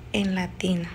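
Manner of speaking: whispered, hushed ending; spooky hushed whisper. Real, not acted. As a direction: breathe the words out in a whisper. Eerie end of ghost story.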